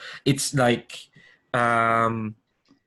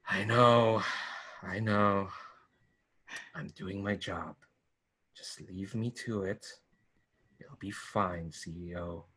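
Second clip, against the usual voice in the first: lightly roughened gruff voice, getting quieter; 5 dB quieter than usual. Acted. Gruff operative reassuring the boss over comms, gruff voice. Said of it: "you hear this rough, kinda, like, gruff voice".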